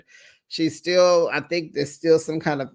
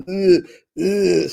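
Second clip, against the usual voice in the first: imitated loud gagging; mock retching; 4 semitones higher, 5 dB louder than usual. Vlogger recapping a guest starting to vomit, mock retching. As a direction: act out a heave, twice. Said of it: "Uba starts to vomit".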